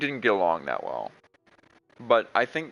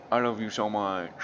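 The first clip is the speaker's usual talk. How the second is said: ordinary talk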